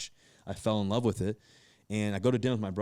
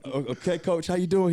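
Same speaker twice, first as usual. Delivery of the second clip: higher and louder, giddy with surprise; starstruck excitement; 9 semitones higher, 6 dB louder than usual. Retold or imitated. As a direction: stumble over okay, thrilled the coach called. Surprise call from a famous coach.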